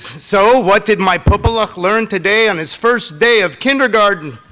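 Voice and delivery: loud, fussing mother piling on endearments; a mother who can't let go at the school gate; loud doting mom calling after her son. Acted.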